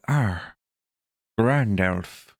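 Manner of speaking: lightly voiced old wizard; a hint of a wise old wizard; Gandalf voice. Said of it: "He's obviously gonna go".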